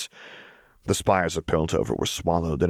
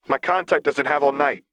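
put-on higher, impatient boy; young boy voice; 8 semitones higher than usual. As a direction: hurry them along. Echo urges them.

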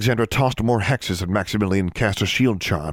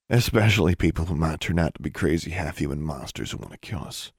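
low, quiet, emphatic answer; understated, lower-pitched insistence; firm retort; 4 semitones lower, 3 dB quieter than usual. Acted. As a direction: answer back low and level, falling away at the end. Brother's firm answer echoing her.

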